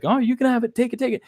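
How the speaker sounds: mock-excited "take it, take it"; eager urging. Din urging the child.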